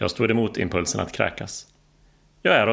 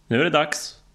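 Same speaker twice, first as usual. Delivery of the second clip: raised, higher-pitched announcement; higher, keyed-up call; 7 semitones higher than usual. A narrator's reading. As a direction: call it out to a jump partner. Instructor announces jump over plane noise.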